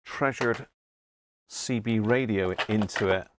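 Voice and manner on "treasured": normal talking voice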